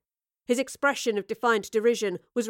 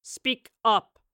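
captain demands the professor speak up; captain's order; 4 dB louder than usual. A narrator's reading. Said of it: "the captain ordered".

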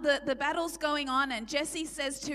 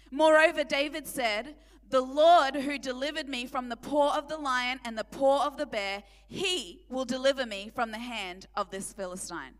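forceful preaching; 5 dB louder than usual. Real, not acted.

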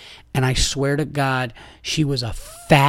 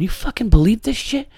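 much higher, incredulous; outraged disbelief; 9 semitones higher than usual. Retold or imitated. Dad vents to his family about the woman, incredulous.

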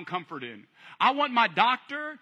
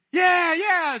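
high, loud crowd answer; the crowd egging the walker on, high and loud; eager crowd; 7 semitones higher, 5 dB louder than usual. Acted. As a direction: shout back like a cheering crowd.

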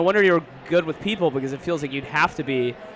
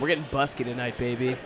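sweet-talking partner; 5 semitones lower, 5 dB quieter than usual. Pretend. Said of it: "what I'm gonna tell Gloria".

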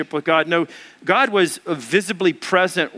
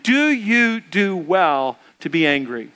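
slow, deliberate scripture reading again with the room; slow unison Bible reading; slow reading aloud; 2 semitones lower, slower than usual. Shown as a demonstration. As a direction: lead the room through it slowly. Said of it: "Let's read that together again".

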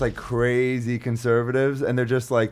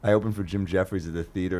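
ordinary delivery

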